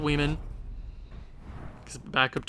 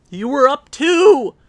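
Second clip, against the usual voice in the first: louder, higher, slow outraged yell; exasperated shout; 9 semitones higher, 11 dB louder, slower than usual. Real, not acted. Gamer ranting at his team after blowing a lead. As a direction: hammer each word in disbelief.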